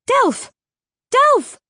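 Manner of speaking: high, loud frightened calling; frantic cry. A narrator's reading. Vega calling to her friend in agony, panicked. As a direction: scream his name in panic.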